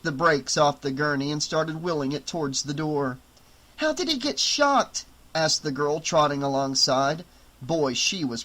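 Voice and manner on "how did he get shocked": put-on girl's voice. Girl trotting after medics.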